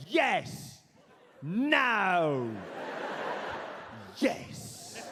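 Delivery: hammed-up rock star, curt stretched words. Rock star who never asks politely, curt and imperious. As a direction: drawl each single word as its own verdict. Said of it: "[name] talked like this".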